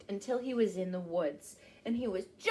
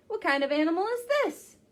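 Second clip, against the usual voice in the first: ordinary delivery, nothing marked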